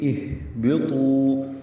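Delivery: اہبطوا slow, final vowel held two counts; اہبطوا with the madd held; drawn-out ihbitu. Shown as a demonstration. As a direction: stretch اہبطوا with the long u held. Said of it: "دو حرکات لمبا کریں گے".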